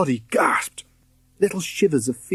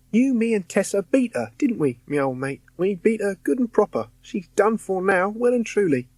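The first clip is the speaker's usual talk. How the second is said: high, cheeky cockney lad; chirpy ghost friend teasing; cockney ghost boy; 9 semitones higher than usual. A narrator's reading. William the ghost reassuring Roddy, cheeky and high.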